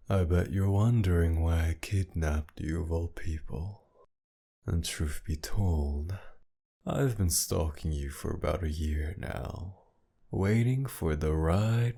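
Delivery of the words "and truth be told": ordinary delivery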